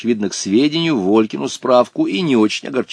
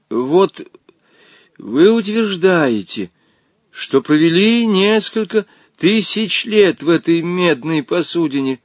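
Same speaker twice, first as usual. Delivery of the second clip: halting, nervous questioning; trembling voice; 6 semitones higher, slower than usual. A narrator's reading. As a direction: pick the words out timidly, a slight tremble. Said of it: "произнёс Женя дрожащим голосом". (робко, дрожащим голосом)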